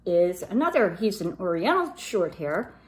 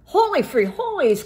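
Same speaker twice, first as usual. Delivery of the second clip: higher, louder exclamation; cry out; 8 semitones higher, 5 dB louder than usual. Acted. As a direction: yell it as you strike. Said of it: "cried Scapito".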